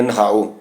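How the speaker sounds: ਨਹਾਓ with a soft h straight after the n; half-h pronunciation. Shown as a demonstration. Teacher demonstrating the bathing word, half-h pronunciation. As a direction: let a half h through in ਨਹਾਓ. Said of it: "ਅਸੀਂ ਅਧਿਹਾ ਦੀ ਆਵਾਜ਼ ਕੱਢਣੀ ਹੈ".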